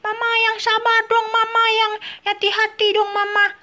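put-on high little-child voice, mildly scolding; a toddler telling Mama to be patient, pitched high; baby scolding forgetful Mama, high child voice; baby Tom Tom voice.

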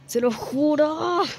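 high, drawn-out rising wail; insistent. Real, not acted. Player swearing to viewers she is good, insistent wail. Insists on her parkour skill.

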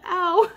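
small high yelp of pain; mild ow. Real, not acted. Reader feels a jolt in her chest, high light ow. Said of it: "a little poke in the chest".

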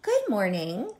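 lilting, friendly greeting; a light, singsong good morning; friendly ladybug. Acted. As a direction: greet sweetly as a friendly little bug. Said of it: "said the friendly ladybug".

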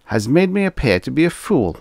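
aggrieved superior airing a grievance; rumbled. A narrator's reading. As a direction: complain with wounded dignity. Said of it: "he rumbled".

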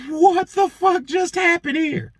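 high, drawn-out disbelief; incredulous reaction. Retold or imitated. Shopper stunned by overheard scolding, incredulous. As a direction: stretch it out in high-pitched astonishment.

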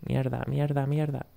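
soft frustrated swearing; cursing under breath. Real, not acted. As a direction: swear to yourself, barely audible. Caught, swearing quietly. (maldiciendo entre dientes)

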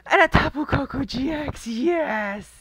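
loud, thrilled cheer; excited cheer. Real, not acted. Pack opener cheering at a rare pull. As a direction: shout it out in triumph.

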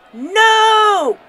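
high, long drawn-out shout; screamed protest. A narrator's reading. Father jumping to his feet in the silent ballpark, screaming. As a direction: scream it in outrage from the stands. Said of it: "he screamed".

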